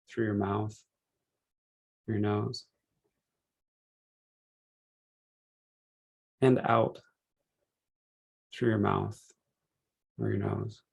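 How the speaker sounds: unhurried meditation guide, measured phrases with long pauses; calm, spacious relaxation-session delivery; guided meditation. Real, not acted.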